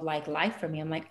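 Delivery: ordinary talk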